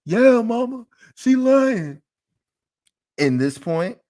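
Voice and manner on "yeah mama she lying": put-on higher, louder child's voice; a kid insisting to mama; kid's voice. Child telling mama the teacher lied, in a higher kid voice. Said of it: "some of us might have even heard this phrase".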